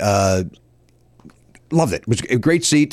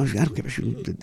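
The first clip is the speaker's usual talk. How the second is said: much softer, under the breath; muttered aside; 9 dB quieter than usual. Retold or imitated. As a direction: mutter it so she can't hear. Retold muttered retort.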